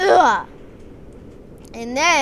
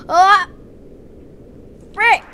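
startled cry; 6 semitones higher, 7 dB louder than usual. Acted. Jeremy startled in fight.

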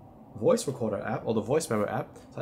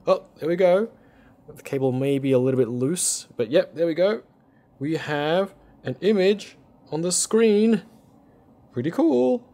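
excited; 7 semitones higher, 7 dB louder than usual. Real, not acted. Image appears on the monitor.

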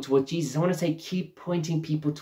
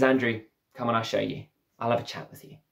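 compassionate invitation; 5 semitones lower, 5 dB quieter than usual. Retold or imitated. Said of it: "Jesus is really compassionate".

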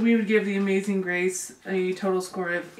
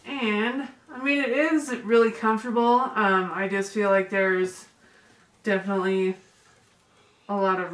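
muffled behind cloth mask; speaking with mouth covered; through a face mask. Real, not acted. Seen 1: Woman wears orange cloth mask over mouth throughout the labelled words.

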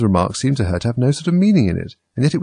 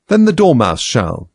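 loud, slow, higher-pitched decree; crying out; 5 semitones higher, 6 dB louder, slower than usual. A narrator's reading. Hatter and March Hare picking the storyteller, crying out. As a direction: proclaim it firmly, drawing it out. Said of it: "they both cried".

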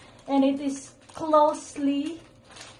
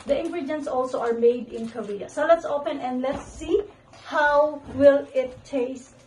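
usual speaking voice

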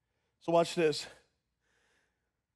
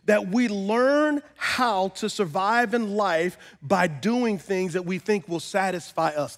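ordinary talk, nothing marked

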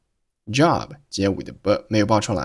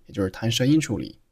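ordinary talk, nothing marked

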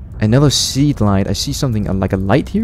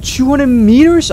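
disbelieving; 12 semitones higher, 3 dB louder, slower than usual. Real, not acted. Reacting to the depth.

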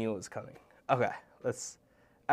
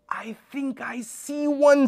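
higher, louder thrilled hush broken; excited discovery; 9 semitones higher, 7 dB louder than usual. Acted. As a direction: announce the find with thrill. Mom spots jaguar.

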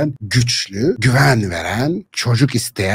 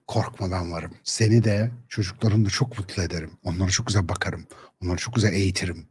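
soft, hushed, much quieter promise; tender reassurance; 5 semitones lower, 7 dB quieter than usual. Retold or imitated. The ideal husband softly promising his wife to care for everything. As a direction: murmur it gently to calm her fears.

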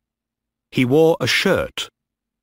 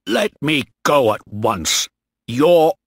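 indignant order building louder and higher; angry protest; 4 semitones higher, 4 dB louder than usual. Acted. Captain held by mutineers, angrily protesting. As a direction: demand release, rising until cut off.

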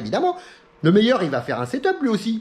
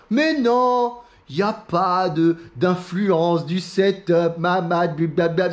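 slow, drawn-out mocking of the doubters; mock naysayers; much slower than usual. Mimicking know-it-alls who deny setups matter, slow mocking drawl. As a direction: drag the words out, dissolving into mumbled blabla. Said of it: "les mecs qui viennent vous dire".

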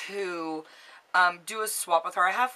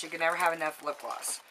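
usual speaking voice

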